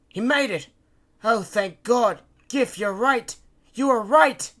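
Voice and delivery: high, loud, excited cries; elated relief. A narrator's reading. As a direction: shout with joyful relief. Relief at seeing the flare.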